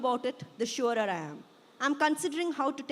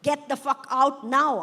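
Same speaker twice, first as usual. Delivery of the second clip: loud, higher angry shout; angry scream; 4 semitones higher, 10 dB louder than usual. A narrator's reading. Daughter ordering her parents out, angry shouting. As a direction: shout it with force on each word. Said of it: "I scream".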